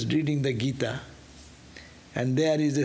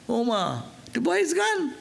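startled cry; 9 semitones higher than usual. Retold or imitated. Wife cries out, boy vanished.

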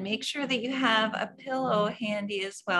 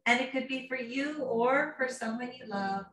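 a lightly soothing yoga teacher guiding a meditation; guided meditation. Acted. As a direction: ease the class into calm. Leads a guided relaxation.

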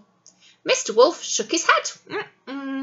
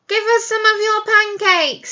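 high, pushy and loud demand; bossy greedy neighbours; a hint of rotten neighbours demanding; rude demand; 9 semitones higher, 4 dB louder than usual. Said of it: "said the rotten lot".

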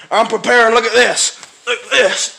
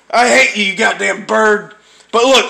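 ordinary talk

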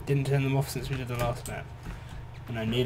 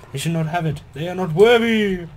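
dramatic voice; 6 semitones higher, 10 dB louder than usual. Acted. Reading note in dramatic voice.

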